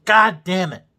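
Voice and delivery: loud, sharp swear; exasperated outburst. Real, not acted. Ranting about Star Wars, a loud exasperated curse. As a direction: explode in frustration. Frustrated outburst mid rant.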